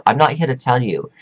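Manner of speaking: usual speaking voice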